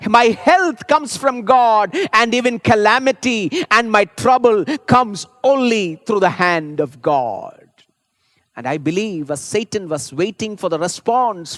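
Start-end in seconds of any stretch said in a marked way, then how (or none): 0.1-7.7 loud, slow, declaiming each line; proclaiming preacher. Retold or imitated. Preacher proclaims Job's faith to the congregation, loud and slow. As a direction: thunder out each truth slowly from the pulpit. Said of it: "Job says".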